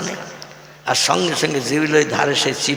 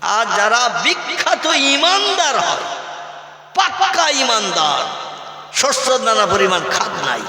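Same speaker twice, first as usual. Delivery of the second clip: preaching at full force; 4 semitones higher than usual. Acted. Preacher emphatic, drawn out.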